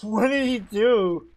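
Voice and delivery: slow, loud stunned question; a character floored by the wall breaking; shocked. Acted. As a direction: gasp it out in disbelief.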